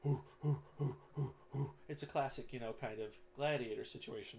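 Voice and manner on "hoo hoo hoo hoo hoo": short repeated crowd hoots; imitated crowd chant. Goblin crowd cheers on the fight, rhythmic hooting. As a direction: hoot in rhythm like an arena crowd. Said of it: "the crowd roars uproariously".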